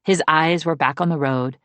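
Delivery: ordinary delivery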